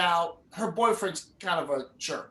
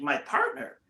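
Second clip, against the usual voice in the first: incredulous; 11 semitones higher, 3 dB quieter than usual. Retold or imitated. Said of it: "You're like".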